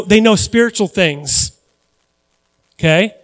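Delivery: normal talking voice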